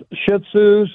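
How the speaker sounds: ordinary delivery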